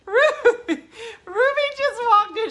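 squealed through laughter, very high and loud; helpless laughter. Real, not acted. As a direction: barely get it out for laughing. Cracking up at Ruby's reaction.

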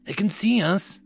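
high-pitched, voiced urgent warning; frightened whisper. A narrator's reading. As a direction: warn them with a touch of fear. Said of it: "the voice whispered frighteningly".

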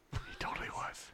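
hushed, conspiratorial whisper; playful whisper. Pretend. Podcaster shushing a friend who says he wasn't Mysterio, whispered. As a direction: whisper it like a secret. Hushing friend over the joke.

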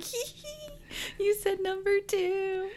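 put-on high, giggly little-kid voice; silly schoolkid teasing; childish giggle. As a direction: snicker at it like a child. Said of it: "I'm such a child".

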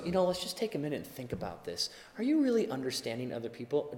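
soft, quick, soothing reasoning; calm an angry partner down gently; calm spouse. Retold or imitated. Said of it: "your spouse comes up to you and goes".

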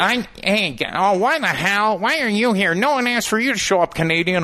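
high-pitched indignant mimicry; making a fuss. Spider-Man performer objecting to Robin turning up, high mock indignation. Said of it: "starts putting up a fucking fuss".

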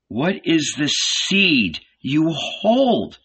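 anxious. A narrator's reading. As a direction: ask it slowly, afraid of the answer. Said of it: "Zebedee's son anxiously repeated".

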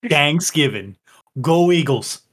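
"Thanksgiving" with a hard d start, Philly style; Delco accent. Acted. Player cheers his home team, Delco accent. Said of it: "a Delco Thanksgiv".

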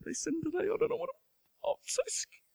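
high, breathless, panicky whisper; mock scared God. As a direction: play a frightened, fussing god for laughs. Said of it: "God does not up in heaven going".

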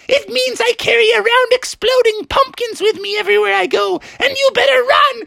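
very high, menacing goblin threat; goblin villainess. Acted. Anne warns the frogs to run, high wicked goblin voice. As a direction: threaten gleefully, ending in a cackle.

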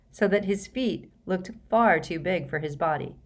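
plain reading voice

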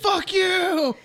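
high, drawn-out curse; mock angry cry. Co-teller voicing Tama raging at Andy, mock fury. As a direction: throw out a high theatrical insult. Acted villain's outburst.

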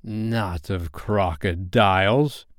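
"crocodiles" drawn out slowly, rising at the end; slow correction, lifting on "crocodiles"; correcting. A narrator's reading. Hook correcting his bosun, "crocodiles" stretched and rising. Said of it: "Hook corrected him".

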